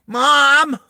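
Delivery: put-on high, loud whiny kid call; bratty kid yelling for his mom. Spoiled kid calling his mom from another room, high and loud. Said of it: "he's like".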